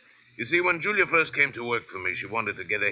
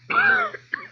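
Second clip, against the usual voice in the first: wheezing cough; 4 dB louder than usual. Acted. Drum hands for his bottle.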